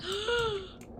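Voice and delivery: high, sharp gasp; sudden dramatic intake of breath; shocked gasp. Pretend. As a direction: gasp in mock horror. Reacts to eat the cat option.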